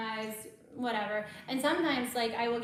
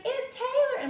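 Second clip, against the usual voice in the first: calling out; 13 semitones higher, 6 dB louder than usual. Retold or imitated. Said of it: "she's like".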